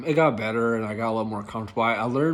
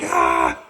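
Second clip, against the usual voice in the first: imitated yelp of pain; 8 semitones lower, 11 dB louder than usual. Passenger's finger caught in window.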